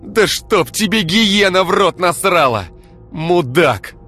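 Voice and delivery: high, loud, slow furious curse; woman struggling to claw at her enemy, screaming. Acted. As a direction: spit the curse at him. Ira fighting to get free.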